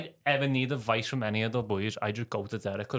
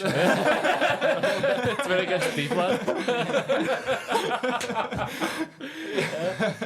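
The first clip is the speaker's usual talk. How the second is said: high laughing words, drawn out; 13 semitones higher, 6 dB louder, much slower than usual. Real, not acted. Mates in the sauna, laughing high and slow. Joking about deep sauna talk.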